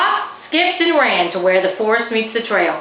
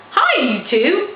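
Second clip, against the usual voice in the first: exclaimed; 8 semitones higher, slower than usual. A narrator's reading. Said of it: "Faith's dad exclaimed".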